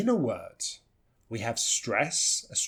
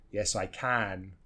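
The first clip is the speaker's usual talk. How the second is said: slow answer with CAN in its full vowel; low, deliberate, full-vowel "can"; stressed CAN; 5 semitones lower, 5 dB quieter, slower than usual. Shown as a demonstration. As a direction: land on a long, clear CAN. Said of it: "in the stressed version".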